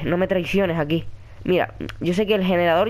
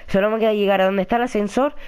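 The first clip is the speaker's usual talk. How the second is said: usual speaking voice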